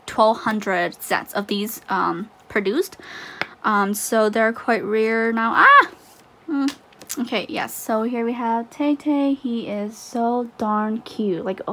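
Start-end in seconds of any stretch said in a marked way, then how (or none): 5.5-5.9 short, high, loud yelp; sudden excited "ah" shriek; delighted squeal. Real, not acted. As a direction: let out a quick squeal.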